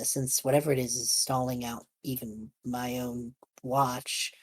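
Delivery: ordinary delivery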